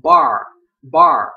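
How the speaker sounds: bar with a loud American R; loud teacher demo of bar. Shown as a demonstration. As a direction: belt out bar, curling the R. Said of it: "có âm R".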